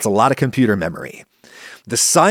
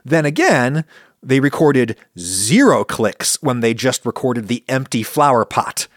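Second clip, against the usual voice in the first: ordinary talk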